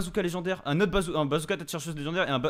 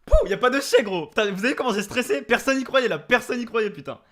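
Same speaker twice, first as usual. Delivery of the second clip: loud, high, thrilled; victory cheer; 7 semitones higher, 6 dB louder than usual. Real, not acted. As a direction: shout the win out. Streamer just won the match.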